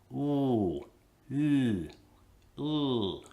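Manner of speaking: rounded "ooh" in place of an L; faked L as ooh. Shown as a demonstration. Teacher mocking a learner's shortcut. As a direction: fake the L with rounded lips. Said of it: "I'm just gonna fake it".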